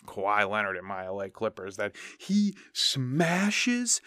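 hands on temples, ham up the pundit's disbelief; Skip Bayless. Retold or imitated. Host imitating a sports pundit raging at LeBron, hammed-up and high. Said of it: "both hands on my temple and just going".

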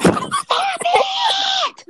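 a full-throated high scream. Retold or imitated. Student screaming at annoying boys in group project. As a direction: scream it at the top of your lungs. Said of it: "I just screamed on the top of my lungs".

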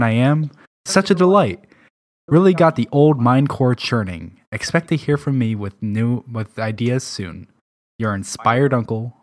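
lively, grand reading of a letter; a lightly voiced eccentric uncle; dramatic letter reading. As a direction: read it like a flamboyant uncle's letter. Reads a character's letter aloud.

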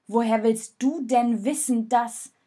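loud, cutting demand; heated challenge. Acted. Jonas doubts Rosa, loud and heated. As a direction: fire the question back.

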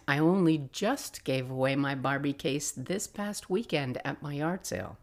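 normal talking voice

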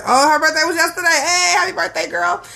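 high, quick excited call; suddenly remember and cheer for her; excited birthday cheer. Real, not acted. Realizes birthday, calls out congratulations.

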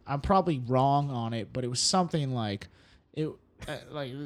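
usual speaking voice

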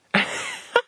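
high laugh bursting out; sudden high laugh; burst of laughter. Real, not acted. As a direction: crack up at the story. Laughing at own story.

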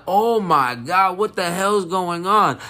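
high, drawn-out mock alarm; acted shock. Retells shock at RIP hashtag.

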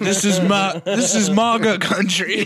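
high, loud and slow, laughing through the boast; mock militia slogan. Mocking pickup-truck militiamen in Chicago, hammed-up laughing boast.

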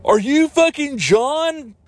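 high, drawn-out dramatic call; hammed-up movie urgency. Time traveler finding the hero in a fake trailer, hammed-up urgency. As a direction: call out in mock disbelief, up high.

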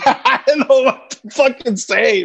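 high, drawn-out words through laughter; laughing. Real, not acted. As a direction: laugh helplessly while protesting. Laughing at his own flimsy excuse.

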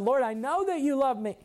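high, exasperated retold protest; frustrated. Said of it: "Peter was frustrated".

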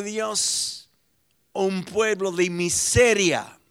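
loud, rising, forceful sermon voice; fiery preaching. Acted. Pastor to his congregation, loud fiery preaching. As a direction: preach it out and hammer each ya. Retelling the Red Sea with force.

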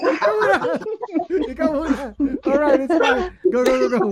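high, loud laughing protest. Real, not acted. Co-host passing the turn to another guest, laughing. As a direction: push the turn away through laughter.

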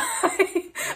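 quick breathy laugh; a short unvoiced chuckle. Real, not acted. Laughs at own weight.

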